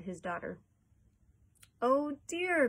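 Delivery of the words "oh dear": dismayed, raised exclamation; crying out. A narrator's reading. Boy watching the family fall, dismayed cry. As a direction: exclaim it higher and louder, in dismay. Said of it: "cried Charlie".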